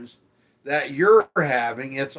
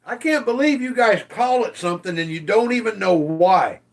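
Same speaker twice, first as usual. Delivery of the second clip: usual speaking voice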